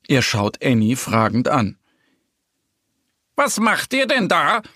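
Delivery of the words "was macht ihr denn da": high-pitched indignant call. A narrator's reading. Boar catches the friends with his bicycle, calling out. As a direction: call out high, without real shouting. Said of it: "ruft es lautstark".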